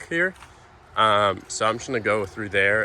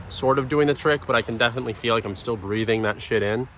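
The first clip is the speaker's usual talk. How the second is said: ordinary delivery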